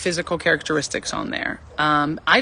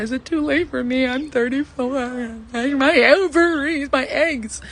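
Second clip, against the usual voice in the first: mock panic; 7 semitones higher, slower than usual. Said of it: "and I thought".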